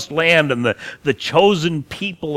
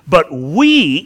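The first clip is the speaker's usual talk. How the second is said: "but we" with we punched louder; stress on we; 6 dB louder than usual. Shown as a demonstration. Preacher contrasting believers with them, stressing "we". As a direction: hit "we" to set them apart. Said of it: "in contrast to them".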